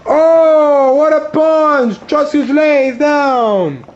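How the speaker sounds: high, slow excited call. Acted. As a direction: call the big punch. Knockout punch commentary.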